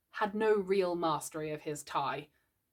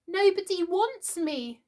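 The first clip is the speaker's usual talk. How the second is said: put-on high, slow, tearful small boy; a little boy on the edge of tears; nearly crying; 11 semitones higher, 3 dB louder, slower than usual. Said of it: "Michael had nearly cried".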